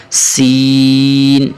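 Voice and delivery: long chanted سین; drawn-out seen. Shown as a demonstration. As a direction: stretch the سین out slowly. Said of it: "یہاں تھوڑا سا سین کو کھینچیں گے".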